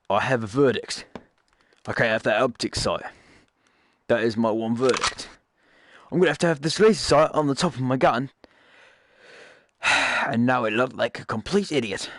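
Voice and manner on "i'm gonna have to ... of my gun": ordinary delivery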